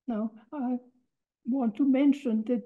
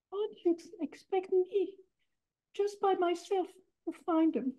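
much higher and softer, wide-eyed; feigned helplessness; 9 semitones higher, 6 dB quieter than usual. Retold or imitated. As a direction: play it innocent and small. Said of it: "And Fella responds".

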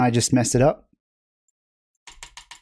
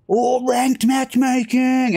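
slow, much higher announcer-style hype; mock hype; 10 semitones higher, slower than usual. Streamer mock-hyping the ranked mode, announcer-style. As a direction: sell it like a game show host.

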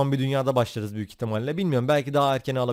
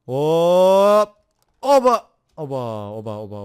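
loud, high startled yelp; surprised exclamation; 3 semitones higher, 10 dB louder, much slower than usual. Real, not acted. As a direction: yelp at the sudden drop. Sudden fall into world. (yüksek sesle şaşkın ünlem)

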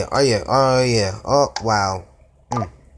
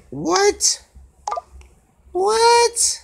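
shocked squeak. Real, not acted. Shocked at the game.

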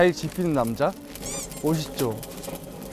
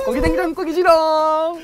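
장난스럽게; 11 semitones higher, 4 dB louder, slower than usual. Real, not acted. Teasing reveal of the dish.

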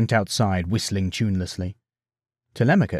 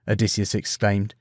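usual speaking voice

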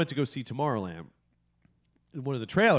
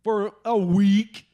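slow, high, loud incredulous question; exasperated disbelief; 8 semitones higher, 6 dB louder, much slower than usual. Real, not acted. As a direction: stretch it out in outraged disbelief. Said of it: "nicotine-deprived hate fest".

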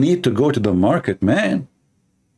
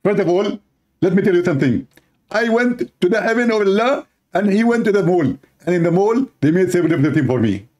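higher, boastful husband voice; husband's voice; 7 semitones higher than usual. Acted. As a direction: brag to the wife in a raised voice. Husband answers wife in skit.

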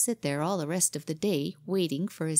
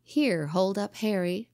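ordinary delivery, nothing marked

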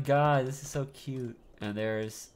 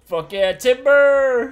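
loud, slow victory yell; triumphant shout; 11 semitones higher, 11 dB louder, much slower than usual. Real, not acted. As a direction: bellow it like a lumberjack. Tree falls, triumphant shout.

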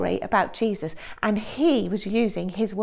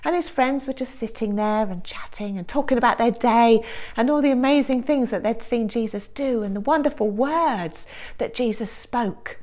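normal talking voice

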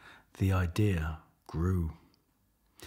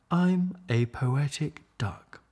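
lightly higher duck, proud of herself; 6 semitones higher than usual. Acted. Duck boasts to Leonard, lightly higher duck voice. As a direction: say it as a cheerful duck. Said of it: "said Marianne".

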